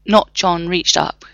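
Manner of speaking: usual speaking voice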